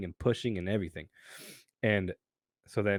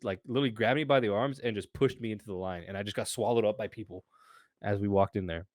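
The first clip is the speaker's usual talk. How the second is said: ordinary talk, nothing marked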